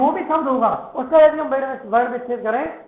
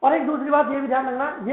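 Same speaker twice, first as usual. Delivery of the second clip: usual speaking voice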